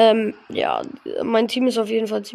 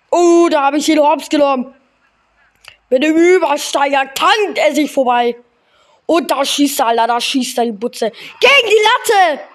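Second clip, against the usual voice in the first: excited; 3 semitones higher, 6 dB louder than usual. Real, not acted. Live reaction to a shot.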